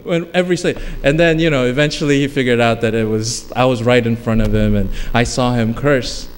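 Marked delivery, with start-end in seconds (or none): none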